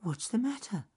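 high little bunny asking gently; squeaky, downcast rabbit; concerned rabbit. A narrator's reading. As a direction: ask it in a tiny, worried rabbit voice. Downcast rabbit asks friend.

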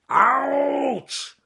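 high, loud howl of pain; bear roaring in pain. Acted. Bitten bear howls at the pike, high and loud. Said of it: "roared Charles Growly Bear".

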